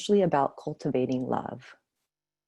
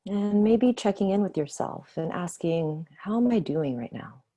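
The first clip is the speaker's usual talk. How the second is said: guided meditation. Acted. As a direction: ease them gently back to the room. Leads closing of meditation.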